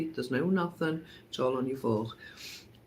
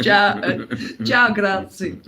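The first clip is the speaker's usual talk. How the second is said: laughing fake Italian ciao grazie, louder; mock Italian accent; 3 semitones higher, 7 dB louder than usual. Retelling leaving the bar, laughing mock Italian accent. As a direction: try on the Italian accent through giggles. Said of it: "I'm even trying to do the accent".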